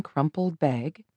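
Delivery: ordinary delivery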